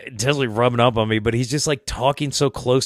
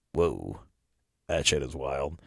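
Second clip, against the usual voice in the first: low, hushed retold amazement; understated, awed aside; stunned awe; 7 semitones lower, 8 dB quieter, slower than usual. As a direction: drop low and soft, quietly impressed. Retelling his stunned reaction.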